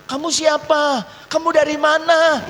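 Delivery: high-pitched mimicked questioning; mock interrogation. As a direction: parody someone grilling a demon. Mocks questioning of possessed people.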